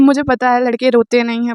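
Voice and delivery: ordinary talk, nothing marked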